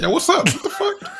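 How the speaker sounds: startled. Retold or imitated. Partner woken by screaming.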